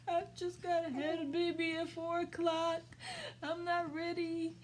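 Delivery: high, whiny sobbing voice; mock crying. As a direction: wail it through pretend tears. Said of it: "crying and crying and crying".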